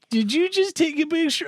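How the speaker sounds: high-pitched imitation of indignant girls; mock accusing question. Girls catching him taking a picture, mock accusing.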